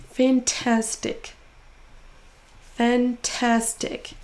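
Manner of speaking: slow "Fantastic" with TAS stressed; stressed syllable drawn out. Shown as a demonstration. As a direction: stretch the middle syllable of "Fantastic". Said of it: "en faisant traîner un". (deuxième syllabe de « Fantastic » traînée)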